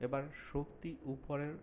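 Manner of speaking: slow, drawn-out phrases with long pauses; unhurried relaxation instructor, lingering on soothing words; guided meditation. Acted. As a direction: guide them calmly through a body scan. Leads a guided body relaxation.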